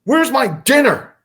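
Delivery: loud, high imitated bellow; angry dad. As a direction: holler upstairs like a furious husband. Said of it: "And he's angry".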